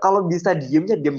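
ordinary delivery

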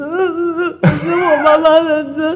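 high, hammed-up childish sobbing; little girl fake-crying to the police, high and wailing; mock crying. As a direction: whimper it like a little girl bawling. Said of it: "我就当场立马哭".

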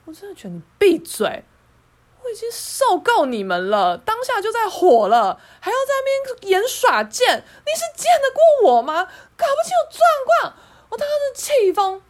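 high, loud, near-tearful fury; furious rant. Real, not acted. Ranting about the snide colleague, furious and loud. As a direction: let the anger boil over.

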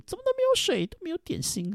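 high, whiny mock passenger griping; shrill mocking of a demanding passenger; whiny complaint. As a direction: whine like an entitled traveller. Mimicking a complaining passenger at counter.

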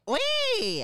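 high call. Acted. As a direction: call for attention. Professor calls out.

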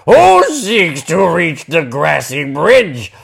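crying out troll. Acted. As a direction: challenge the goat from the bridge.

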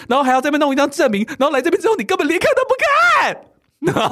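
screaming in disbelief at the attendant; furious outburst. Real, not acted. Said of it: "我整个情感又回来了".

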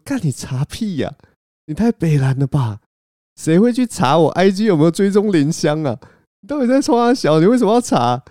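high, loud flustered protest rising to a yell; indignant outburst. Retold or imitated. Caught-out speaker yelling back at friend, flustered outrage. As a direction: defend yourself loudly, embarrassed and caught. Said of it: "我第一时间的反应".

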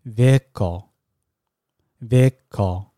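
Vehicle said slowly and softly, with a silent h; careful model pronunciation. Shown as a demonstration. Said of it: "你才开口试着模仿它". (慢慢轻声示范Vehicle，h不发音)

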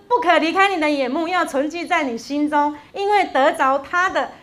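preacher reading scripture aloud with weight; scripture recitation. Acted. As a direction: proclaim the verse to the church.

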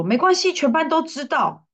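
lightly put-on young student; imitating a student.

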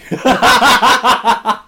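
loud, high cackling burst; real laughter. Real, not acted. Storyteller reveals absurd fare, loud laughter.

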